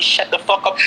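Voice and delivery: ordinary delivery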